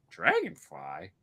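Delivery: slow, questioning imitation of a child; puzzled four-year-old. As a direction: sound out a new word like a puzzled kid. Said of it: "He's four".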